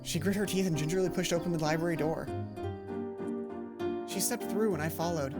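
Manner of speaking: ordinary delivery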